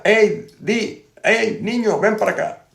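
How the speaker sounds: high, carrying call across the street; mock-call the boy over from the doorway. Café owner calls the boy over from his door, high shouted call. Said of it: "abre la puerta y le grita".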